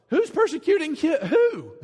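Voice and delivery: high, loud, startled "who?"; high and loud, caught off guard; bewildered protest. Acted. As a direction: blurt it out, baffled and indignant. Confused Saul on the ground.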